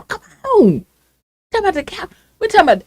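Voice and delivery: high, pleading disbelief; disbelieving plea; exasperated. Real, not acted. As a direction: come on, you can't be serious. Exasperated rant about capitol.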